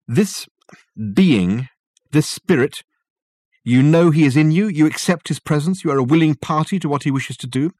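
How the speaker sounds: loud, high, pressing questioning; intense interrogator. A narrator's reading. Dirk cross-examining the possessed Michael, intense and pressing.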